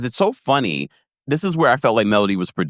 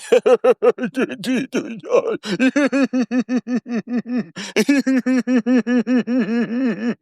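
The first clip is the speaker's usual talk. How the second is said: high-pitched, long rattling giggle; imitated giggling fit; 11 semitones higher than usual. Recapper mimics Maurice cracking up, high giggle. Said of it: "when Maurice was like".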